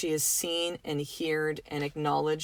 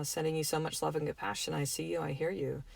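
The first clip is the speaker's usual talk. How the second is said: soft inner prayer; 2 semitones lower, 7 dB quieter than usual. Shown as a demonstration. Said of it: "say a little prayer inside your head".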